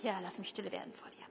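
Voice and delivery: breathy whispered prayer, a bit quick. Shown as a demonstration. Repeats the prayer to himself. (leise geflüstertes Gebet)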